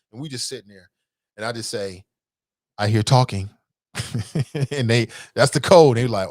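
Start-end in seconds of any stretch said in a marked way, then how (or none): 2.8-3.5 stern dad warning. Retold or imitated. Dad's code to stop kids.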